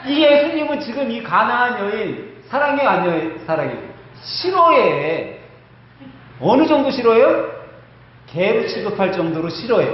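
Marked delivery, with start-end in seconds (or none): 4.2-5.4 very slow, dragged-out word; drawn-out reluctant answer. Pretend. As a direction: stretch it out teasingly. Jesus' feeling, teasing congregation.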